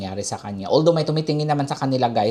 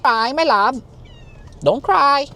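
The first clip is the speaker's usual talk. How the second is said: very high, loud pet talk; cooing comfort; 13 semitones higher, 4 dB louder, slower than usual. Real, not acted. Comforting her crying cat.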